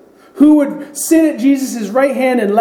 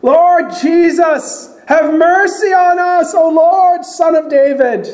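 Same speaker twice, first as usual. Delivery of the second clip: screaming plea; 6 semitones higher, slower than usual. Retold or imitated. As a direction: scream for mercy at the top of your voice. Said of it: "now they're screaming".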